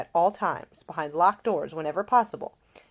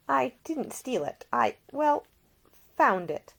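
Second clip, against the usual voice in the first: higher, halting guilty confession; Ida, flustered; 7 semitones higher than usual. A narrator's reading. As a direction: fumble the excuse, caught out. Ida caught out.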